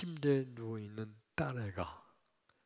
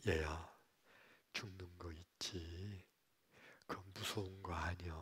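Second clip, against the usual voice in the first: tender father; 4 semitones lower, 5 dB quieter than usual. Retold or imitated. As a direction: soothe a scared child softly. Father comforts dying daughter. (딸을 안고 다정하게 달래는 아빠)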